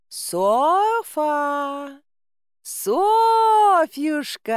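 a tender call into the dark forest; calling tenderly. A narrator's reading. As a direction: coax the lost girl back sweetly. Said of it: "постаравшись вложить в голос ласковые интонации".

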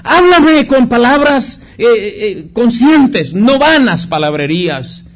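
muy agudo y fuerte, lento, proclamando; predicación ferviente. Acted. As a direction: proclama las palabras del Señor. Cita al Señor con fuerza. (el pastor proclama con fuerza la cita del Señor)

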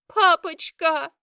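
high, drawn-out desperate scream; loud wail. A narrator's reading. Girl pinned down calling her father, screaming. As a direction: cry out for rescue at full force. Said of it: "Этот громкий вопль".

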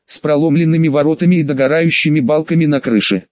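plain reading voice

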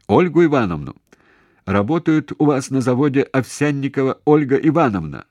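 loud, high voice for a bad line; shouting. A narrator's reading. Caller asks the factory for Овсянникова, shouting over bad line. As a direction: shout the name down the receiver. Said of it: "он всё время кричал".

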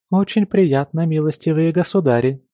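high, bright chirpy maid voice; chirping. Acted. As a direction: chirp it like a cheerful little bird. Stranger curtsies, greets.

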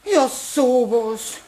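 slow, drawn-out disbelief; dismayed. Acted. Frau Eichinger over the spilled drawer, slow dismay. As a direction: shake your head over the mess.

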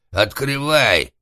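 loud, drawn-out rough order; rough demand. A narrator's reading. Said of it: "грубо потребовал Карл". (грубое громкое требование)

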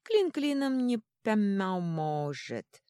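high, loud, very drawn-out pronouncement; little god's voice. A narrator's reading. Little god shaking his cat head, drawn-out doubtful voice. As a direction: stretch the words slowly, gliding down from high.

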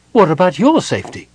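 high, loud, sharp demand. A narrator's reading. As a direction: throw his worry back at him. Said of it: "she demanded".

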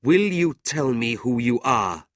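insistent demand. A narrator's reading. Brother following man into street, insistent. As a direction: press him for an answer.

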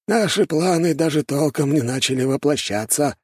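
high-pitched elder, lightly scoffing; scoffing old man. A narrator's reading. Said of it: "хмыкнул худощавый старик в белом".

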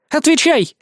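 high, loud sharp command; commanding shout. Acted. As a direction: demand an answer now. Kikimbi demands an answer. (властный окрик)

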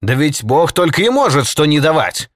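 loud, high indignant outburst; angry exclamation. A narrator's reading. Jake rages about what God forbids, loud and indignant. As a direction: cry out in outrage. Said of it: "восклицал Джейк".

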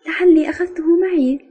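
high, guileless reply; innocent. A narrator's reading. Member answers about a missing pencil, innocently. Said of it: "قلت ببراءة".

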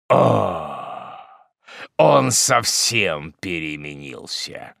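slow, lightly voiced grim hermit; dark hermit. A narrator's reading. Hermit answers the mother with a grim smile, slow. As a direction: let the irony hang.

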